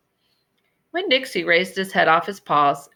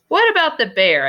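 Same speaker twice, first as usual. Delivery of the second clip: put-on high, louder, eager child's question; 10 semitones higher, 5 dB louder than usual. A narrator's reading. Opal pressing the old librarian, eager and high. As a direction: blurt it out, dying to know.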